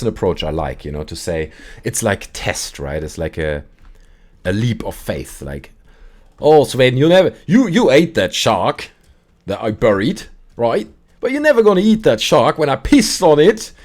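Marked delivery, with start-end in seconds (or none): 6.4-13.7 loud, high mock taunt; goading dare. Storyteller playing a man daring Sven, loud taunt. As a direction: egg him on in a raised, acted voice.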